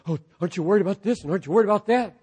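rapid, fluttery worrier voice; mock nervous fretting. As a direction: gabble it like an anxious busybody. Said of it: "Somebody gets all nervous".

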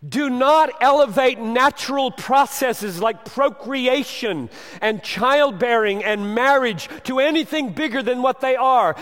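loud, high preaching at full force; thundering sermon voice; fiery preaching. Acted. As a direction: preach it hard to the congregation.

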